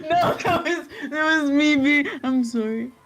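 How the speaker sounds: high, loud giggly apology; laughing apology. Real, not acted. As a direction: apologise through real giggles. Apologising while laughing at interruption.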